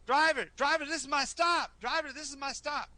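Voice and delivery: high, loud call to the driver. Acted. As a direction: shout up front so the driver stops. Passenger calling to wagon driver.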